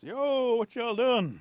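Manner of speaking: high, loud, brash hail; calling out. Acted. Rabbit hailing two women at work, calling out. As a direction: holler it across to them.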